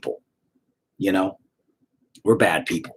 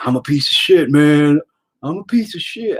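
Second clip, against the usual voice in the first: quoting a crying fan; 5 semitones higher, 5 dB louder than usual. Retold or imitated. Crying fan quoted after show.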